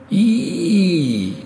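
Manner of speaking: long, high wailing choir note; chanted high "eee". A narrator's reading. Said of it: "chanta le chœur dans le lointain". (long chant aigu de chœur)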